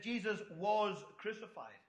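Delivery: slow, deliberate stress on "was"; emphatic WAS. Acted. Preacher quoting the angel to the congregation, emphatic "was". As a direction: lean firmly on "was" in "Jesus was crucified". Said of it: "he is emphasizing Jesus was crucified".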